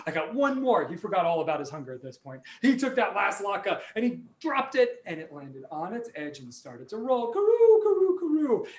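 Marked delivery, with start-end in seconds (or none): 0.1-0.9 high-pitched flustered shout; high excited panic. Acted. Old man panics, latke rolling. 7.3-8.7 high, loud sing-song rolling noise; high rolling sound. Acted.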